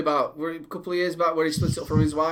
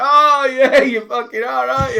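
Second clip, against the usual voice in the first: loud, high sarcastic scoff; imitating a scornful sceptic; mock disbelief; 7 semitones higher, 7 dB louder, slower than usual. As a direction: scoff loudly at an obvious tall tale. Retold disbelieving reply to Rowan Atkinson.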